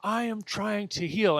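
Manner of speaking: declaring it to the universe, half-pleading; mock declaration. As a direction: insist to the universe, building. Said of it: "declaring this to the universe".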